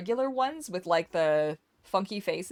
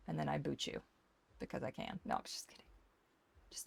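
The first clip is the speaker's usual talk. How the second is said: soft unvoiced teasing aside; whispered joke; 5 semitones lower, 14 dB quieter, faster than usual. Real, not acted. Joking threat, whispered.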